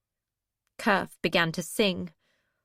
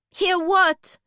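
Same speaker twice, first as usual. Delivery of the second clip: demanding; 9 semitones higher, 6 dB louder than usual. Acted. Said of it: "she demanded".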